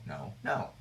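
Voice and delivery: normal talking voice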